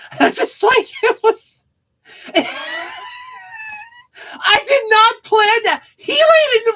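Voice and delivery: high, squealing laughter through the words; laughing hard. Real, not acted. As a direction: crack up until it squeaks.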